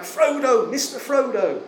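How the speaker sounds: high, carrying call across the river. Retold or imitated. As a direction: call out to a friend far off. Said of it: "shouting out".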